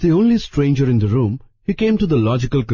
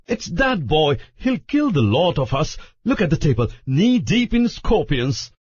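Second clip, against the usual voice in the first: raised, indignant rant; angry Larry; 6 semitones higher than usual. A narrator's reading. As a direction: fume at the boy's mess. Larry ranting at the table.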